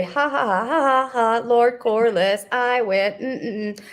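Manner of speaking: mock smug laugh, then gloat; hammed-up self-satisfied schemer; gloating Otto. Acting out Otto's smug gloating.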